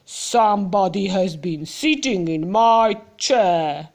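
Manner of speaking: lightly voiced big bear; mild growly bear grumble; Papa Bear's growly voice. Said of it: "in his loud, growly voice".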